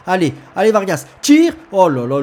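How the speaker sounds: loud shouting at the striker; excited sports commentary. Acted. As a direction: yell for the shot. Urging a shot.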